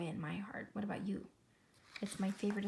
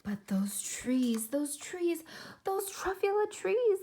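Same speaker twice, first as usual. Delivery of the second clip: rising higher and higher in awe; building wonder; 9 semitones higher, 7 dB louder, slower than usual. Acted. Onceler recalling first sight of the trees, building. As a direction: swell with delight on each trees.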